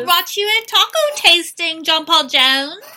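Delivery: high and loud, sugary; overly sweet girly voice; high girly mock voice. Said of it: "she's like".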